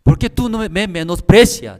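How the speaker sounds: high, loud indignant question; angry pastor. Retold or imitated. Angry pastor confronting the seminarian, high and loud. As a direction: demand to know why he is despised. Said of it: "pero el pastor se enojó".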